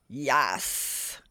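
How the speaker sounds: low ogre growl. Acted. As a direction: growl it out like a brute. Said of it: "growled the ogre".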